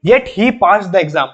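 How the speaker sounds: very slow, word-by-word English example; deliberate dictation pace; slow example reading. Shown as a demonstration. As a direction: read it out slowly for students to copy. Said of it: "example दे रहा हूं".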